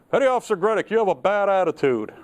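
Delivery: lightly hammed-up telling-off from a superior; mock reprimand. Superior officer telling off a petty officer, mock reprimand.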